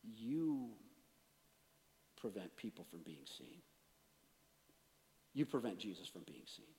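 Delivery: low, soft, broken by long pauses; God's quiet conviction. Retold or imitated. God turning the indignation back on the preacher, quietly convicting. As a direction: let each phrase land quietly, in an imitated divine voice. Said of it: "God says".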